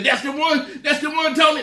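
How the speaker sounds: high, loud excited yell of recognition; excited shout. Retold or imitated. Cousin recognizes the church chicken.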